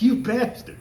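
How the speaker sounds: high, laughing playful insult; laughing mock curse. Trainee laughing and yelling at the instructor, high mock insult.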